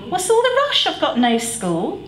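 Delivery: put-on high young boy voice, puzzled; childish boy voice. A narrator's reading. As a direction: play the boy asking why the hurry. Stan voiced, child figure.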